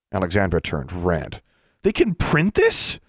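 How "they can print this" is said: high, drawn-out disbelieving demand; outraged high-pitched question; indignant demand. A narrator's reading. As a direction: stretch it out high, appalled. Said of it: "she demanded".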